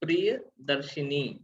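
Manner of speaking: Priyadarshini said slowly, each syllable separated; slow careful spelling-out. Shown as a demonstration. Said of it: "वो शब्द होता है".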